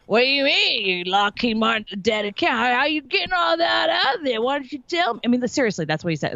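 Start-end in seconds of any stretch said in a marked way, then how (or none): 0.1-5.2 a hint of the nosy older salesman, pitched higher; imitating coworker. Guest imitating the big-bellied coworker who pried at her. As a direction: lightly mimic the prying colleague. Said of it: "That's how he talked to me".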